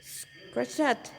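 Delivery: usual speaking voice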